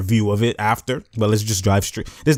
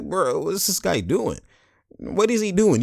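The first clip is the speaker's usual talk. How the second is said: higher-pitched, drawn-out mock disbelief; incredulous; 8 semitones higher, 4 dB quieter, slower than usual. Teammate shaking his head at Draymond, incredulous. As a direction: stretch it out high in bafflement.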